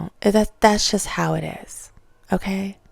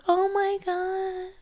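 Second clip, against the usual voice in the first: mocking gushing fan; 13 semitones higher than usual. Vlogger mocking fans who suddenly love the show, high gushing voice. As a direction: gush like a late convert fan. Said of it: "they're gonna be like".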